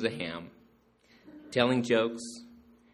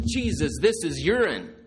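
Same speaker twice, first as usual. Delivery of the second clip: shocked exclamation; 6 semitones higher, 6 dB louder than usual. Retold or imitated. Punchline, man reacts to wine.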